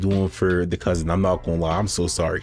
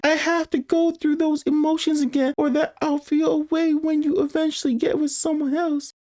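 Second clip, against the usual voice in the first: high-pitched cousin voice; much higher, steady-pitched character read; cousin texting her crush. Acted.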